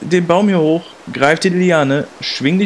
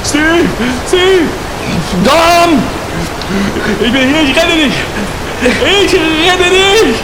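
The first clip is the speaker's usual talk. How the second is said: loud, high-pitched calling out; desperate rescue shout; 9 semitones higher, 4 dB louder, slower than usual. Acted. Man shouting to his friend in the river, desperate calls. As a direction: yell over the water, stretching the words.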